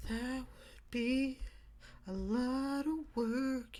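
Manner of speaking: high, stretched-out and slow; slow high drawl. Shown as a demonstration. Podcaster musing to listeners, drawn-out high voice. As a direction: drag each word out up high. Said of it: "keeps playing with the phrase".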